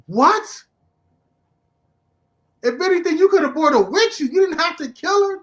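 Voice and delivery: high, loud incredulous outburst; outraged disbelief. Retold or imitated. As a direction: shout your disbelief at the screen. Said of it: "I was like".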